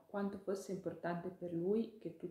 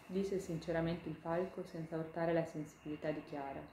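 usual speaking voice

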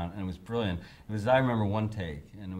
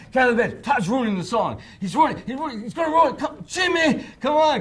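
frantic yelling; 8 dB louder than usual. Retold or imitated. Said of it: "He says".

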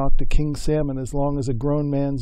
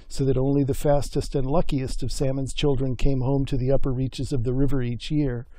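plain reading voice

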